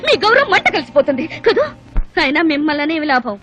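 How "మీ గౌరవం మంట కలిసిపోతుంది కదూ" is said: higher, heated scolding; scathing rebuke. Acted. Woman rebuking wives over their ego, angry. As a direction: tear into their pride.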